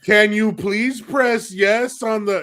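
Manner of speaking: holding back a laugh while repeating it patiently; mom trying to stay composed. Retold or imitated. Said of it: "composed herself".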